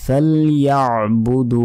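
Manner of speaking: ফালইয়াবুদু slow, throaty ain after ya, long final oo; careful "fal-ya'-budoo" for ফালইয়াবুদু; correct pronunciation. Shown as a demonstration. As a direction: squeeze the ain, stretch the end of ফালইয়াবুদু. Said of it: "দুতে এক second টেনে".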